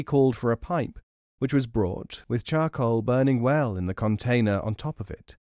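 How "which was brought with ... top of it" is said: plain reading voice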